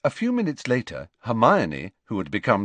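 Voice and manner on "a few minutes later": ordinary delivery, nothing marked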